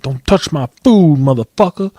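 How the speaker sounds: put-on feisty warning; worked-up retold warning. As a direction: imitate someone all riled up. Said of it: "he was all G'd up".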